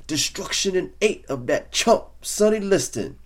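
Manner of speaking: high, loud boxer's trash talk yell; screaming boast. Retold or imitated. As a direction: scream the prediction at everyone. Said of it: "screaming to any and all".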